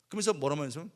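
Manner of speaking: ordinary delivery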